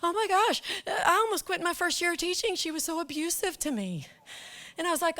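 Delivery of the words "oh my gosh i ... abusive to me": high, earnest confiding; a higher, heartfelt colleague voice; grateful colleague. Retold or imitated. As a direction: confess it with relief. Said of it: "This one lady says".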